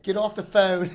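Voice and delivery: a hint of a Delco local yelling; Delco accent. Local girl in line mocking in a Delco accent. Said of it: "Delco accent".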